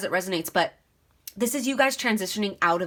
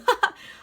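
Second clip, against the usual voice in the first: short high burst of real laughter; embarrassed laugh; 14 semitones higher, 4 dB louder than usual. Real, not acted. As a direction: laugh it off after choking. Laughs at own choking.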